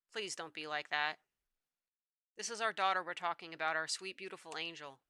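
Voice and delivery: lightly voiced gentle father, pleading; a hint of a soft, low doting dad.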